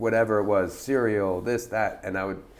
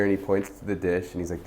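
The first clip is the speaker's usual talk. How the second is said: normal talking voice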